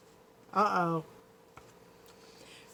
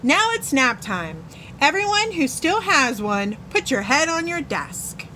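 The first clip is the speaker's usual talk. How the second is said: menacing teacher; 6 semitones higher than usual. A narrator's reading. Witch teacher orders nap.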